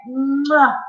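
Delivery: usual speaking voice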